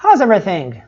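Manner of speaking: quick contracted How's; natural native speed. Shown as a demonstration. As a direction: run How's everything together fast. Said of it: "vão falar sempre assim".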